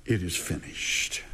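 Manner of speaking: low, hushed, solemn quote; hushed forgiveness. Retold or imitated. Preacher voicing Christ's final words from the cross, low and hushed. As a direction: drop low and let the words settle softly. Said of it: "his words come full of forgiveness".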